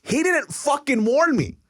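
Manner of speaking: high-pitched, drawn-out exasperation; exasperated complaint. Real, not acted. Storyteller complains his buddy gave no warning, exasperated. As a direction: stretch it out in comic indignation.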